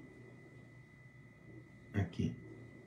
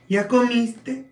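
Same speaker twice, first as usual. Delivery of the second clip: much higher, louder mock voice; polite Mexican question; 12 semitones higher, 4 dB louder, much slower than usual. Oaxaca family asking if he ate, high mock voice. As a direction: ask it sweetly, the proper way. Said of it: "ellos no decían".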